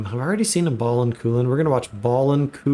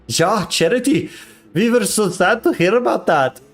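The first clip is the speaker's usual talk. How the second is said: higher, hammed-up German accent; cartoonish German accent, pitched up; 5 semitones higher than usual. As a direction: put on a lilting mock German voice. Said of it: "German accent".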